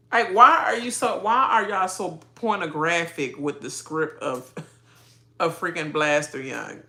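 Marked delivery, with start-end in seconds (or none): none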